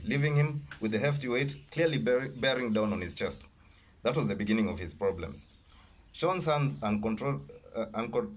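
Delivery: usual speaking voice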